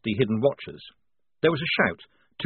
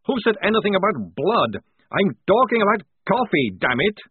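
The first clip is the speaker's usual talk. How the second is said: louder, higher, snappish; irritable outburst; 9 semitones higher, 4 dB louder than usual. Acted. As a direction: snap back, on edge. Craving vampire snaps back.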